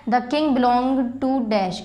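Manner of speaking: very slow, word by word, for students to write; slow dictation. Shown as a demonstration. Teacher reading a worksheet line to the class, slow dictation. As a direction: read it out slowly so the class can copy.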